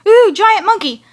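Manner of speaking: high, loud mock wonder; excited reaction. Fan acting out seeing Babylon, excited. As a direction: burst out high on the ooh.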